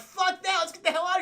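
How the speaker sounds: put-on frightened boy voice; panicked kid. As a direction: blurt it high and loud. Scared kid wants to flee.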